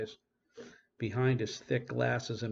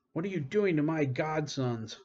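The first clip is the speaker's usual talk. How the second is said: higher, louder accusing question; angry demand; 6 semitones higher, 4 dB louder than usual. A narrator's reading. Lyle confronting Jeremy over his godsons, loud and higher. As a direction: confront him hard.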